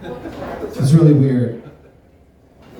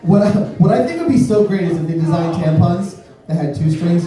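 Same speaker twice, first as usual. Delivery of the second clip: ordinary talk, nothing marked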